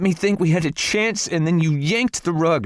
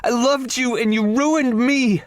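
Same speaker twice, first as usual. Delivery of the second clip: screaming; 13 semitones higher than usual. A narrator's reading. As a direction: scream it up high through hurt. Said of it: "Morgan's voice was turning hoarse from screaming".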